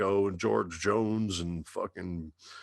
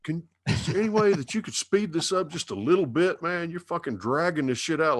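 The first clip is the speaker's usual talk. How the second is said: much higher, fast mocking whine; impatient city guy; 8 semitones higher than usual. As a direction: hurry him along like a fed-up rushed listener.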